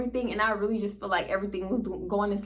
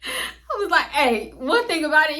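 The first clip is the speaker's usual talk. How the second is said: high and laughing through it; giggling while she gets the words out; 8 semitones higher, 4 dB louder than usual. Real, not acted. Retelling how she demanded the pen, laughing high.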